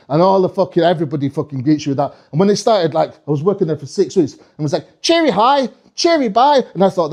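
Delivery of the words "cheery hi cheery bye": high, rising singsong; elven greeting. Acted. Elf greeting visitors, high singsong. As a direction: chirp both greetings, pitched up.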